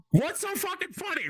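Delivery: high-pitched mock offence; mock indignation. Comic to a laughing crowd, mock indignant. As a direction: round on the laughers, playfully affronted.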